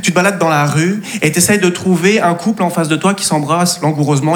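ordinary talk

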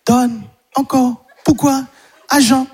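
put-on high, slow toddler voice; little kid sounding out first words. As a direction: chirp each word like a two-year-old. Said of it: "Il sort plein de petits mots".

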